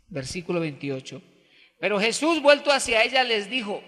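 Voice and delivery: ordinary delivery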